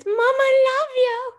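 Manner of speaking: very high, slow voice for the cat. Pretend. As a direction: speak for the cat. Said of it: "Sirius's voice is like this".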